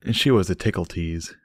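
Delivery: ordinary delivery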